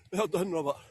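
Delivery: ordinary talk, nothing marked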